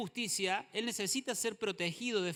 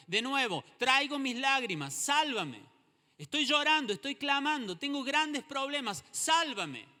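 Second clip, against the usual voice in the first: louder, higher crying-out plea; fervent plea; 7 semitones higher, 5 dB louder than usual. Acted. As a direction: cry out to God for rescue. Voices psalmist's plea.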